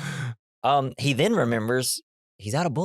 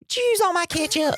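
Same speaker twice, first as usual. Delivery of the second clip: high-pitched Southern-sounding Michigan mom; bad Michigan mother accent. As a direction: squawk it in a botched Michigan accent. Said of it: "making the Michigan mother sound".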